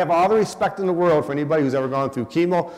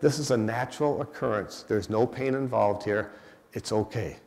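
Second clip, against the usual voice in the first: softer, lower calm reassurance; 6 semitones lower, 7 dB quieter than usual. Retold or imitated. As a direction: soothe the panicking family gently. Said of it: "she very calmly says".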